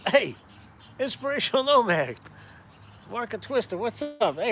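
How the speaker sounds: high, loud welcome to a viewer; loud, excited welcome to a viewer joining the stream; excited greeting. Real, not acted. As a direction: call out the name with delight.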